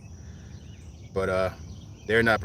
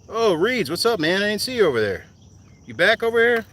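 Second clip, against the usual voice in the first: high, loud shout to a friend; real shouted greeting, pitched way up; calling out; 12 semitones higher, 7 dB louder than usual. Real, not acted. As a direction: holler a surprised hello across the distance. Calling out to a friend spotted across water.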